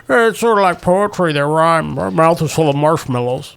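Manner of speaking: lightly voiced mumbly old director; mumbling George Lucas. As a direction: mumble it like a mouth full of marshmallows. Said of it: "George Lucas got".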